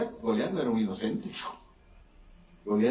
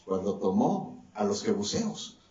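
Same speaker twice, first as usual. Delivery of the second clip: ordinary talk, nothing marked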